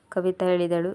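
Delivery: usual speaking voice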